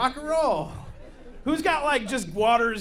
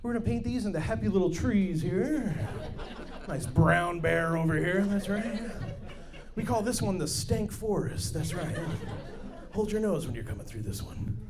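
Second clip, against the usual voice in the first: Bob Ross voice; 4 semitones lower, 3 dB quieter than usual. Retold or imitated. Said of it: "I was like".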